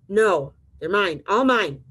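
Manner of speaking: loud, slow grouchy protest; storybook shout of a selfish grouch; mock scream. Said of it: "screamed the grouchy ladybug".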